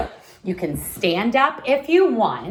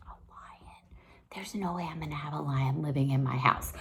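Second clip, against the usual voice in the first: quick, very soft whispered aside; hushed aside; 5 semitones lower, 12 dB quieter, faster than usual. Real, not acted. Teacher answering her own question for children, hushed whisper. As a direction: whisper it fast, like a confidential admission.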